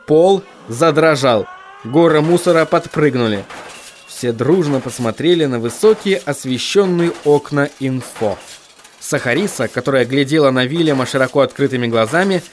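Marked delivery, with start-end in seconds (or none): none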